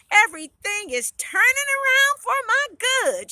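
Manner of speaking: high, loud jubilation; excited and happy. A narrator's reading. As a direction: proclaim it, overjoyed at your luck. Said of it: "She was so excited and happy".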